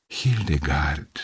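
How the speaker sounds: slow hushed cry of recognition; soft surprised exclamation. A narrator's reading. Seeing the girl again, he exclaims her name softly. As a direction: breathe the name in stunned wonder. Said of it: "exclamă".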